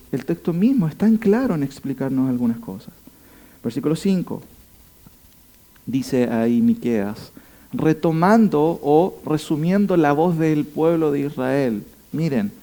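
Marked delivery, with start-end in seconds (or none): none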